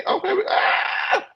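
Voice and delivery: high, slow excited squeal. Real, not acted. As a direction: squeal with glee over the money. Celebrating the money.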